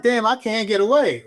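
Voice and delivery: high-pitched, exasperated retold protest; exasperated complaint. Officer replaying his dismay at a war-zone posting, exasperated.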